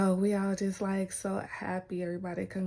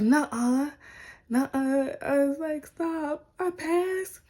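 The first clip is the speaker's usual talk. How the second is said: high, loud squeal of disbelief; higher and higher, can't believe it; excited disbelief; 7 semitones higher, 4 dB louder, slower than usual. Retold or imitated. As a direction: squeal like you just heard great news.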